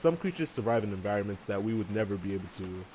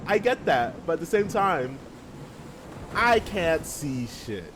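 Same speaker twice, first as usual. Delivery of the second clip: retorting to editor; 7 semitones higher, slower than usual. Retold or imitated. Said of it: "which I'm like".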